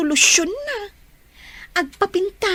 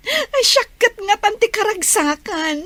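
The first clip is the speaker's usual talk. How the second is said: normal talking voice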